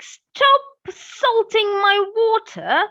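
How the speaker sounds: high, slow croak from the river; croaky voice. Acted. Storyteller voicing a hidden river creature scolding the crying princess, croaky and high. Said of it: "a croaky voice".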